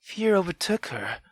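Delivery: ordinary delivery, nothing marked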